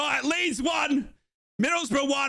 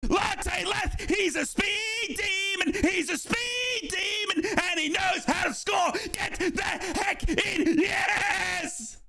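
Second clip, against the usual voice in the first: high, sustained commentator's roar; real goal celebration, pitched way up and held; ecstatic goal call; 7 semitones higher than usual. Real, not acted. Commentator celebrates Middlesbrough's opening goal, ecstatic shouting. Calling his team's goal live.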